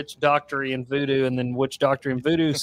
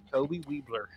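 soft nickname; 11 dB quieter than usual. Pretend. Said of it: "I like to call him".

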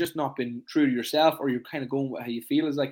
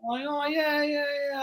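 much higher, hesitant client voice; evasive client; 12 semitones higher, much slower than usual. Retold or imitated. Coach mimics a client dodging the protein question, in a high hesitant voice. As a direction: play the dodgy client caught out.

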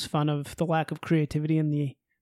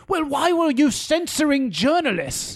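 higher, indignant mimicry of an interviewer; mock challenge; 8 semitones higher than usual. Co-host imitating the reporter grilling an official, mock challenge. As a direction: press the question in a mocking imitation. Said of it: "he keeps being like".

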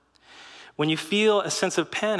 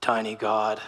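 lower, gruff huff; a low, dismissive growl of a line; Hulk's huff; 6 semitones lower than usual. Retold or imitated. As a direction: Hulk sneering at a beaten god, low. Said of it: "He's like".

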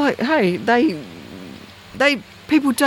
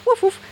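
high hooting woof woof like a barking owl; owl call imitation. Answering a barking owl at night, high woof call. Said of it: "And they go".

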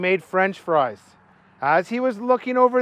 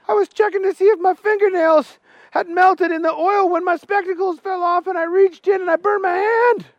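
much higher, simple-minded cook; Booger's high voice; 12 semitones higher than usual. Acted. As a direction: explain the accident in a high, childlike voice. Booger explains his burned hand.

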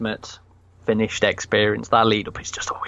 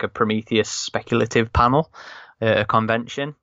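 normal talking voice

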